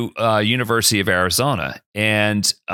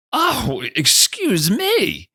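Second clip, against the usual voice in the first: mock taken-aback; 10 semitones higher, much slower than usual. Taken aback by the cocky reply.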